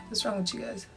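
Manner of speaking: normal talking voice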